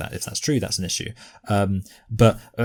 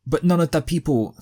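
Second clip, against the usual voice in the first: higher-pitched imitation Dawa preacher accent; 8 semitones higher than usual. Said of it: "Dawa accent".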